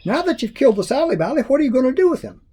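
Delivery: put-on high, quick curious boy; Jack's voice. A narrator's reading. Jack asking Grandma about the dead monster, high and quick. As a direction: rattle the question off high.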